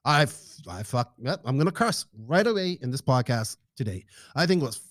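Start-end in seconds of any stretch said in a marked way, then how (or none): none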